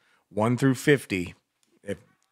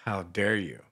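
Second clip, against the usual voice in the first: ordinary talk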